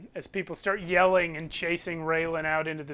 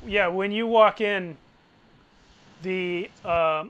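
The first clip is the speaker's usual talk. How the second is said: ordinary delivery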